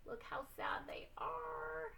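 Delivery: breathy, tearful whisper; sad whisper. Acted. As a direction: whisper it as if about to cry. Sad ending of teacher's speech.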